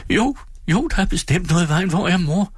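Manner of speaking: put-on high, anxious little boy's voice; a scared child pressing for an answer; frightened child. A narrator's reading. Child David insists, frightened. (høj, ængstelig drengestemme)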